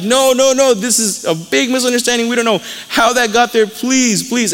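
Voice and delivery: high-pitched, panicky protest, acted out; imitated panic, insisting it's a mistake; freaking out. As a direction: act out frantic pleading of innocence. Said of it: "the brothers again are freaking out".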